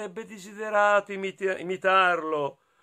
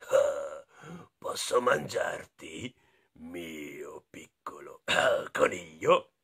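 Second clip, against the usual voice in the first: low, slow, whispery wolf voice; big bad wolf; 12 semitones lower, 6 dB quieter, slower than usual. Wolf asking to eat the little rabbit, low and hushed. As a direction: menace the bunny in a low hush. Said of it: "un lupo gli disse".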